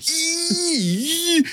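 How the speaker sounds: mimicking Ernest. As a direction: ham up Ernest's disgusted "Ew" from the trailer. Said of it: "him going".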